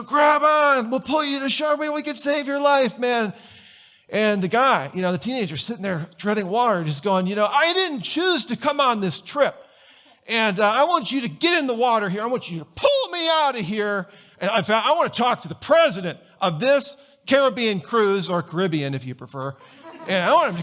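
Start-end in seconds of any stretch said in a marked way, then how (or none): 0.0-3.3 high-pitched, urgent mock shout to someone in the water; mock rescue call. Coast Guard throwing a life preserver to a swimmer, mock calling out. 7.3-18.3 put-on high-pitched, entitled teen complaint; indignant teenager. Stubborn teenager treading water to his Coast Guard rescuers, mock indignant.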